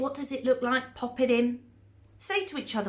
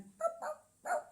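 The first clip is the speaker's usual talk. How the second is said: high playful boop; slower than usual. Shown as a demonstration. Shadow puppet sound effect.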